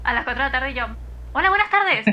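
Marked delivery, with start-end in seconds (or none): 1.3-2.0 high, bright-sounding telemarketer greeting, pitched up; call-centre agent voice. Retold or imitated. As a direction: put on the horrible call-centre voice. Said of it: "le hablaba con acento de teleoperadora horrible".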